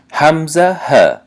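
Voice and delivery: همزه، ه set apart, each sounded from the bottom of the throat; deep-throat articulation. Shown as a demonstration. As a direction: sound همزه، ه slowly from the lowest point of the throat.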